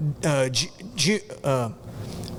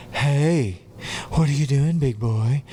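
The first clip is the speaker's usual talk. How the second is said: sexy voice; 3 semitones lower, slower than usual. Retold or imitated. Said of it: "Talking in this sexy voice".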